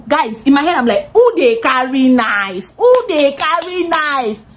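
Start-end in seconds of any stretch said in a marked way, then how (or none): 1.1-4.4 slow, drawn-out mock innocence; mocking retort. She mocks Tim's question back at him, slowly. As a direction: play dumb, slowly. Her mocking reply to Tim.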